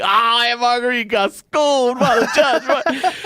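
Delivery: very high, drawn-out gleeful taunt; mock jeering. Family teasing the scolded aunt, high drawn-out mock jeer. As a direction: crow it slowly, way up high.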